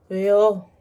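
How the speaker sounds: loud, curt retort; irritated. Retold or imitated. Student answering sister's ex, loud and irritated. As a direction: throw it back in annoyance. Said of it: "너무 열이 받아서".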